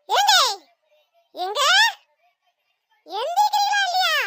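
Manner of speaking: shouted high and loud, half laughing; angry yell at husband. Acted. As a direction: yell him out of bed. Wife yelling husband awake. (தூங்கும் கணவனை எழுப்ப கோபமாக கத்தல்)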